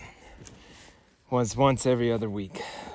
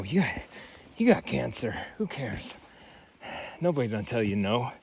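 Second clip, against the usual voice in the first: normal talking voice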